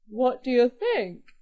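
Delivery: loud, boastful ogre challenge; acted ogre showing off; mild roar. A narrator's reading. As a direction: roar it with moderate force. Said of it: "he roared".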